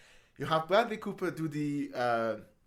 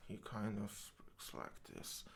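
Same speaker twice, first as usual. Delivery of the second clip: Bradley Cooper; 7 semitones lower, 15 dB quieter than usual. Shown as a demonstration. Showing how an actor speaks through a film, low murmur. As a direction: drop deep and hushed, barely voiced. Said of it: "a particular choice in how he speaks".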